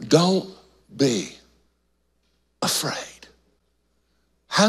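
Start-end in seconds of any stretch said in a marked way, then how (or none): 0.1-3.3 soft words sinking to a whisper on "afraid"; fading whisper. Acted. Pastor voicing Jesus comforting fallen John, fading whisper. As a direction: let it fade into a breath, gently reassuring.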